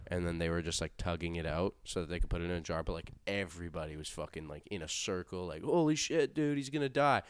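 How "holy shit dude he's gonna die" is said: high, loud freaked-out cry; mock panic among friends; panicked yell. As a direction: squeal it like a scared kid.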